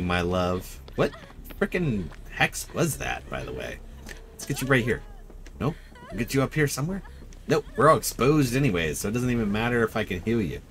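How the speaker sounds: ordinary talk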